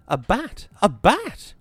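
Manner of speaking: rising panic, near a scream; raving. A narrator's reading. Wisconski panics after a bat dives at them, frantic raving. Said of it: "Wisconski raved".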